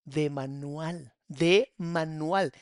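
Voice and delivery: drawn out slowly, word by word; slow emphatic. Real, not acted. Host telling the caller, slow and emphatic. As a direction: spell it out slowly, like a verdict. Host stresses a textbook pattern.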